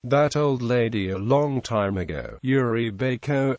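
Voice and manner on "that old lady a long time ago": ordinary talk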